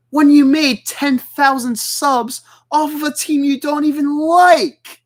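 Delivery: high, slow, rising angry challenge; mad. Real, not acted. Streamer confronting an absent rival over his subs, mad. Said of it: "as mad about it as I am right now".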